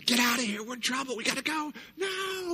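put-on high, loud frantic kid voice; panicked kid shout. Kids yelling to each other to run, high and frantic. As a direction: urge the others to flee.

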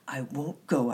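put-on restrained childish shout; defiant shout. A narrator's reading. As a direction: refuse point-blank. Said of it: "I shouted".

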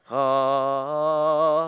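drawn-out mock-holy ah; hammed-up reverent ah; mellow pious sigh. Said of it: "you get all".

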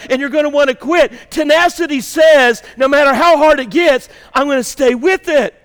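rising pulpit fervour; full-force preaching. Acted. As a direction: push the message hard.

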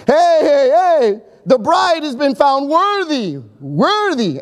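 high, slow proclamation to the crowd; proud announcement. Retold or imitated. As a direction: announce it like a herald at the door. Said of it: "the best man would give the announcement".

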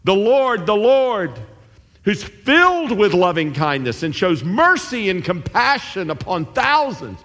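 high, ringing proclamation; proclaiming God. Retold or imitated. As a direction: announce the Lord's name grandly. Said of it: "he says to Moses".

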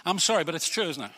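ordinary delivery

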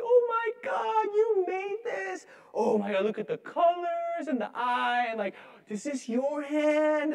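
put-on high, gushing, slow delight over a child's craft; high, drawn-out gushing to a toddler; over-the-top parent praise. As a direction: gush like a thrilled parent. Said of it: "over the top".

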